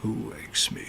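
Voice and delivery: low whispered creature growl; muttered Draconic. Acted. Unseen creature stirring behind the spheres, muttering in a whisper. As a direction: mutter it in a raspy whisper, half to yourself. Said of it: "in muttered Draconic".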